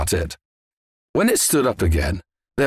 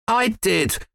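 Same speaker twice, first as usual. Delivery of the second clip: a hint of a young boy, pitched higher; higher-voiced boy owning up; boy's reply; 12 semitones higher than usual. A narrator's reading. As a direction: answer lightly as a boy. Boy answers his excited father.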